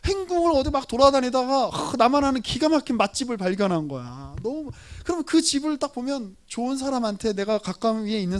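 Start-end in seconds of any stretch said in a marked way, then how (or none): none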